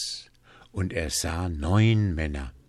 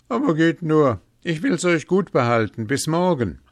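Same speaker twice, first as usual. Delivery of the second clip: loud, high send-off; calling after them; 9 semitones higher, 7 dB louder than usual. A narrator's reading. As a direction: call boldly after departing debtors. Hans calls after departing men.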